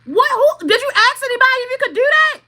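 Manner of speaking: high, loud, fast demand; angry confrontation. Retold or imitated. Confronting the mother-in-law, loud fast angry questioning. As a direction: fire it at her fast and high.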